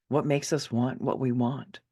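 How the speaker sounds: ordinary delivery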